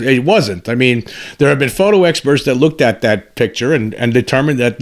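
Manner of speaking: ordinary talk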